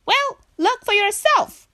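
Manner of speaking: high, loud retort; insistent frightened Frog. Acted. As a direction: protest in a high little voice. Frog argues with Pig.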